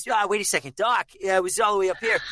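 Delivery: high, youthful throaty voice; young film hero, high and urgent; Michael J. Fox. Acted. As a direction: pitch it all the way up. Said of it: "his voice changes from part one".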